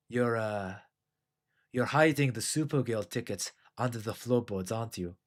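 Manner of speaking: quietly corner him about the hidden tickets; Christoph Waltz interrogation. Retold or imitated. Drinker as Waltz's interrogator, accusing a host, in a soft, low, mildly menacing voice. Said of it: "in place of Christoph Waltz, and he's like".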